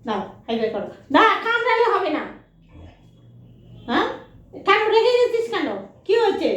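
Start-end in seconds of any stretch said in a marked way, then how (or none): 1.2-6.5 very high, loud pet scolding; high mock-stern pet talk. Real, not acted. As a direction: playfully scold the dog for biting. Scolding biting dog playfully.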